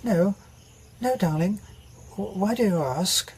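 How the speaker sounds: polite, puzzled posh British reply; genteel British wife. Acted. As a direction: play a well-bred British woman gently asking. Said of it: "a good British name".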